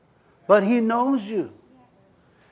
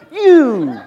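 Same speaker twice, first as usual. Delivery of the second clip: hammed-up yell with a laugh; Jesus yelling at Peter, joking; mock angry shout; 6 semitones higher, 9 dB louder than usual. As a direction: bark it out, half laughing.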